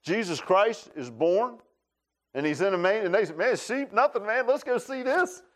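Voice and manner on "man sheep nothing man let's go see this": high-pitched, acted shepherd urging the others on; eager excitement. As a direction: drop everything and rally your friends, pitch lifted. Voices shepherds eager to see Jesus.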